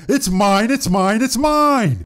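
put-on high childish whine, repeated; possessive whining. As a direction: whine like a kid guarding a toy. [name] whining over nickname.

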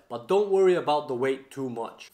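ordinary delivery

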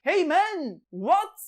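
high, loud mock shout; French calling to the English, loud high shout; shouted hey man. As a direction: bellow it across the Channel. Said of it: "diye bağırıyorlarmış".

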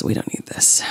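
whispered dismissal; hushed throwaway. Real, not acted. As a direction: toss it off in a whisper. Discarding items, muttering.

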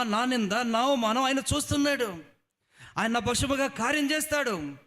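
high, loud fervent exhortation; loud high sermon cry; preaching at full force. Acted. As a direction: lift the crowd with fervour.

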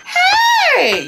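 drawn-out, high-pitched hey; high warning holler; high call-out. Retold or imitated. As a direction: sing out a long, high challenge. Acted outburst in the retold quarrel.

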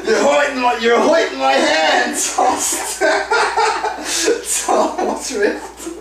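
laughing and shouting. Real, not acted. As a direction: laugh through a strained shout. Struggling to rip the hat.

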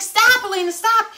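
high, loud call to stop; urgent yell. Retold or imitated. Daughter shouted at in retold scene.

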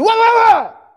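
sudden high, loud demand; husband's loud what. Retold or imitated. Husband coming home, confronting his wife loudly. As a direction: blurt it out like a startled husband.

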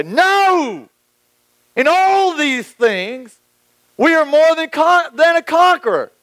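Paul answering shame with a resounding no; triumphant declaration. Retold or imitated. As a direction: declare it like a victory cry. Paul's triumphant reply.

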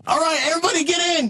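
high, urgent cartoon call; rally the crew at a high pitch; excitable sidekick hollering to the group; calling everyone aboard. Leroy calls everyone aboard.

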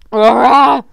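loud sudden cry; alarmed shout. Acted. Zebra crying out at the roar, a loud shout. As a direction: cry out in shock.

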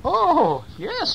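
very high gleeful bees; very high squealing bees agreeing; delighted bees. Acted. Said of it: "said the bees".

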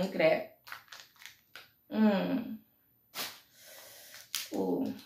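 normal talking voice

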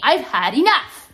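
loud, exasperated declaration; angry end-of-patience statement; fed up. Real, not acted. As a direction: put your foot down loudly. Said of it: "I'm sick of you".

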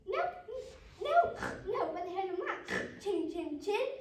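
high, loud little piggy; little pig's refusal. Acted. Frightened pig refuses the wolf.

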